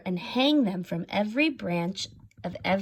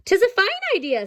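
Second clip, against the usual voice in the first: bright Irish townswoman; 11 semitones higher, 3 dB louder, much faster than usual. Acted. Emily speaks in story.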